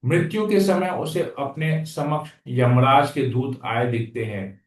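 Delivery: usual speaking voice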